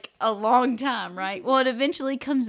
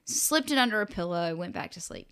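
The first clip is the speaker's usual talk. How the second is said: usual speaking voice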